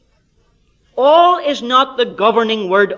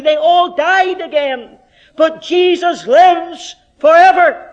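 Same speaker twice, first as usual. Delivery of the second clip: higher, loud, emphatic pulpit preaching; full-force preaching; 10 semitones higher, 6 dB louder than usual. Real, not acted. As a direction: build to a loud, high, triumphant peak.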